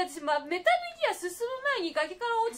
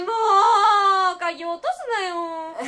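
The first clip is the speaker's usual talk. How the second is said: hit hard crying out; 3 semitones higher, 16 dB louder, slower than usual. Real, not acted. Scared player given key hunt.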